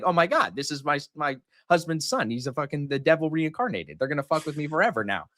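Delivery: lightly voiced amused, exasperated wife; imitated exasperated mom. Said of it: "Now she's laughing".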